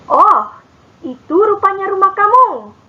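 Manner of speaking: "Oh" lifted, then "itu rupanya rumah kamu" rising high in surprise; realising with a start, voice jumping up; surprised exclamation. Shown as a demonstration. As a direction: sound caught off guard by the house. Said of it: "Oh digunakan untuk menunjukkan perasaan terkejut".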